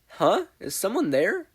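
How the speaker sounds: put-on startled boy asking into the pile; nervous surprised question; startled and concerned. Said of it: "Izuku asked, concernedly".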